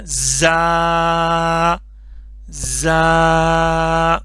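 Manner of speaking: زا said slowly and firmly, a hard buzzing z drawn out. Shown as a demonstration. Teacher models firm za for pupils, slow. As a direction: hold زا long and firm, not like زال. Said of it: "تھوڑا سا سختی کے ساتھ پڑھیں گے".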